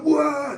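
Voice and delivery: high, loud "whoa"; drawn-out high whoop of shock; crowd's whoa. Retold or imitated. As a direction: imitate a whole queue gasping "whoa". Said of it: "the whole queue was like".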